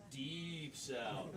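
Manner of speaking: "Deep" drawn out long; stress on Deep. Real, not acted. Host clarifying the audience's suggestion, stressing "Deep". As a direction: lean slowly on the word "Deep". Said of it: "Not just South".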